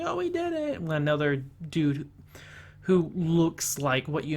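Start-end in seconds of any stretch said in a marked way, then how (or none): 0.0-0.8 imitating giddy girls, much higher pitch; mock a girlish cheer, squeaking up high; high-pitched giddy squeal. Girls cheering at making the finals, mock giddy squeal. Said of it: "super high-pitched giddly screams".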